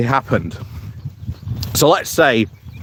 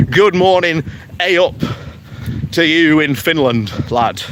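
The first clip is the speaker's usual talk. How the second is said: put-on a light, playful Yorkshire accent, a bit higher; 3 semitones higher than usual. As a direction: greet the viewer like a cheery Yorkshire lad. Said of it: "take my Yorkshire accent off".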